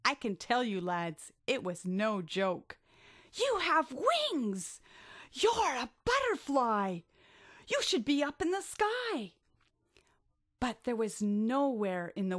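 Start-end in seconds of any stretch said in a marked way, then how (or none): 3.3-9.3 amazed delight. Acted. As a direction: marvel at the wings. Shelley amazed at Billy's wings.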